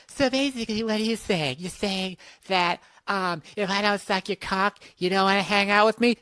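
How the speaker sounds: a hint of a girly voice; girl voice. Acted girl voice retort.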